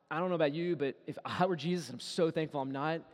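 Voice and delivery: normal talking voice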